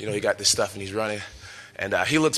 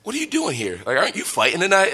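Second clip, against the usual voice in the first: surprised policeman; 7 semitones higher than usual. Retold or imitated. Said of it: "he's like".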